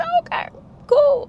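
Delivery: squeaky high, loud fake-nice reply; fake sweet. To her new roommate, a high fake-cheerful "cool". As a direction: chirp it brightly with mock friendliness.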